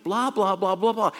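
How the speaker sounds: dismissive mimicry of an endless rant; mock droning on. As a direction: imitate someone who won't stop talking. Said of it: "He just keeps going".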